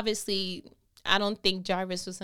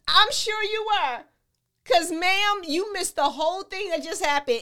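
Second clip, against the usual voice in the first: sassy aside; 8 semitones higher, 6 dB louder than usual. Real, not acted. Preacher teasing Jairus's wife, high and loud. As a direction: tease her knowingly.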